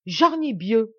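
loud, high burst of indignation; outraged exclamation. A narrator's reading. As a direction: cry out the oath in outrage. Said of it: "s'écria-t-il". (juron indigné, crié fort)

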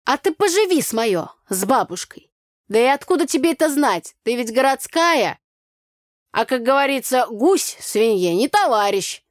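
put-on loud, high folksy village girl; brassy country girl scolding; Tanya's brash voice. Village girl Tanya, loud and brash.

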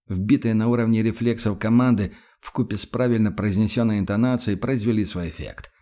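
usual speaking voice

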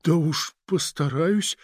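slow, drawn-out thoughtful reply; pensive. A narrator's reading. Agent weighs whose side to take, slow and pensive. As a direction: agree while still weighing it up. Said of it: "в задумчивости проговорил агент".

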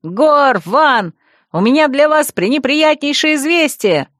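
high, ringing announcement; loud call. A narrator's reading. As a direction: call out to both across a yard. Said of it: "громко крикнула".

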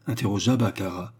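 ordinary delivery

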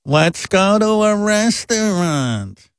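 slow, high nagging mom; mother voice. Retold or imitated. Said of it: "My mother goes".

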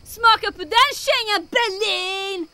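shouting in triumph after the explosion; triumphant shout. Acted. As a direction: crow over Berlin like a winning goal. Triumphant cheer after blast.

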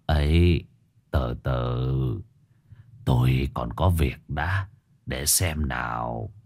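put-on low, soft, drawn-out old man; quiet, deliberate elderly voice; old shaman. A narrator's reading. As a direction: hold them off slowly, in no hurry. Old shaman waves off the host.

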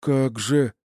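high, shocked disbelief; horrified stammer. A narrator's reading. Анисим looking at the guard's body, horrified and shaken. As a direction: let horror lift the voice. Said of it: "судорожно забормотал Анисим с ужасом и потрясением".

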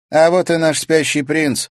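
high, loud mocking girl voice; smirking girl. A narrator's reading. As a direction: taunt him with a smirk. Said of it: "усмехнулась она".

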